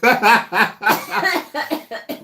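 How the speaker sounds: high, loud laughter; helpless high-pitched laughter; laughing hard. Real, not acted. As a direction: break up laughing. Cracking up at his story.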